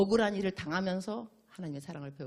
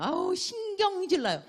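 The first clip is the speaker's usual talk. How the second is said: much higher, louder slow whine; whining; 13 semitones higher, 8 dB louder, slower than usual. Retold or imitated. Preacher imitating a believer's high, slow, irritated whining. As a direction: drag the complaint out, high and irritated. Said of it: "막 이러면서 그냥".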